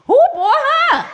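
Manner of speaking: high, squeaky incredulous mock question; imagined snide onlooker. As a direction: squeal it like a gossip staring at her. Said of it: "I know what he thinking".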